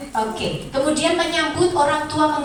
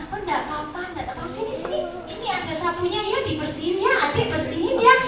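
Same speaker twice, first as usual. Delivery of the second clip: gentle German parent; 6 semitones higher, faster than usual. Acted. Lecturer plays parent over child's mess, gentle coaxing. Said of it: "kalau di sana".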